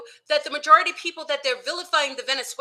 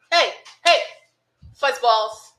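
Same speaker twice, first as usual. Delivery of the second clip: calling the dogs; 5 dB louder, slower than usual. Real, not acted. Calling her dogs over.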